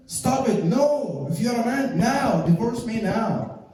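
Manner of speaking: high-pitched insistent goading; mock defiant demand. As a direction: imitate a wife demanding it immediately. Wife goads husband, acted out.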